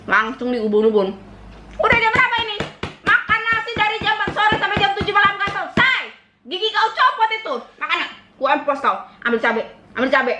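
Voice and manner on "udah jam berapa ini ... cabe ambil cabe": much higher, shrill mock scolding; imitating angry mother. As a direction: rant like a furious mum. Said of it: "Kayak gitu".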